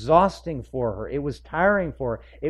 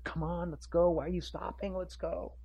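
impatient Jairus; 6 semitones higher, 8 dB quieter than usual. Retold or imitated. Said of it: "Jairus is like".

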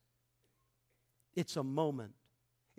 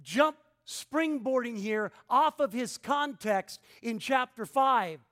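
raised, emphatic pulpit delivery; forceful preaching; 6 semitones higher, 8 dB louder than usual. Real, not acted. Preacher telling congregation what Paul taught, forceful preaching. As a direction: preach it higher and louder, punching key words.

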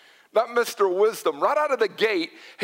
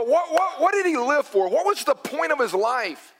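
higher-pitched, acted bewilderment; incredulous questioning; 6 semitones higher than usual. Preacher voices people at someone's death, incredulous questioning. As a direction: blurt the questions, pitched up in disbelief.

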